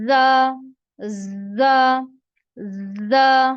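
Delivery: "जा" said loud and forceful with a hiss; loud drilled "जा" for the class; forceful letter demo. Shown as a demonstration. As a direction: hit "जा" hard, teacher modelling. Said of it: "इसको आपने जोर से अदा करना है".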